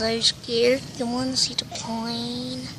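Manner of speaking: normal talking voice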